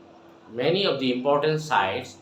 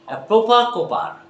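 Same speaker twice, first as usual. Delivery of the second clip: higher, louder drill opener; energetic demo; 7 semitones higher, 5 dB louder than usual. Shown as a demonstration. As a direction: launch the twister with energy. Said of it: "Okay, my dear".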